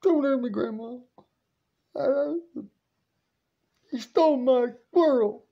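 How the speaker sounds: put-on high, whiny child begging; hammed-up frightened kid voice; mock scared pleading. Grandson begging grandma not to punish him, mock scared.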